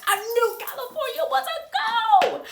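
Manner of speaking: high, loud excited squeal of joy. Retold or imitated. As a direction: squeal it like you just got great news. Retelling joyful news reaction.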